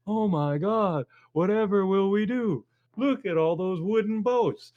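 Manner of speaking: high, mock-panicked wail of a colonist. Colonist gasping at the British fleet, mock panic. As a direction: fret like a helpless colonist at the wooden boats. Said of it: "the colonists are sitting there going".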